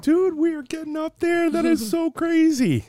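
squeaky excited voice; high-pitched excitement. Real, not acted. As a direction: squeal it out, amazed. Excited squeal about episode count.

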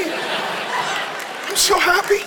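high, stretched-out, choked-up squeal; overwhelmed happiness. Real, not acted. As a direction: squeeze the words out high and slow, overcome. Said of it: "I feel so".

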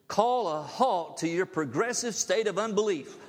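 urging the church to stop doubting, fervent preaching. Acted. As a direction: preach it to the congregation. Rising into preaching.

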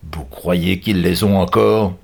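slow villain question; suspicious captor. A narrator's reading. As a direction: ask it slowly, with suspicion.

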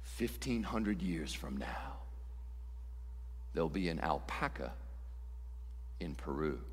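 deadpan solemn oracle, barely above a murmur; God answering. Acted. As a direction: deliver it softly with grave pauses. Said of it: "Okay, I'll answer".

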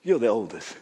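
calm fatherly reassurance; gentle father. Retold or imitated. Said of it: "and then he says".

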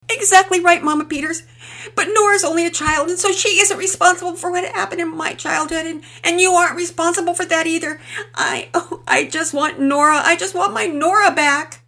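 high, loud, tearful pleading confession; emotional breakdown. Acted. Mother confessing her fault to neighbour, tearful and pleading. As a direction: pour it out on the edge of sobbing.

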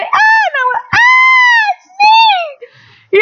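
very high, loud squealing disbelief; wife hears her name called and screams with joy; excited squeal. Retold or imitated. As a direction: shriek with thrilled surprise at being picked. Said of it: "and we're".

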